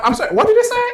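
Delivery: very high, puzzled customer voice; baffled customer. Retold or imitated. Lady at the drive-through can't understand him, high and baffled. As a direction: mimic a customer who missed every word.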